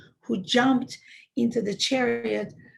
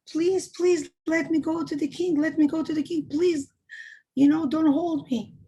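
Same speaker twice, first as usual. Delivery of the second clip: higher, louder urgent begging, short of a scream; mock screamed plea; 5 semitones higher, 4 dB louder than usual. Child to the guards holding him back from the king, mildly screamed plea. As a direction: plead loudly as the struggling child. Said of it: "started screaming".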